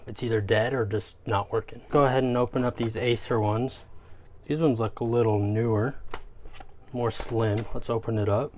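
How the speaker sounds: ordinary talk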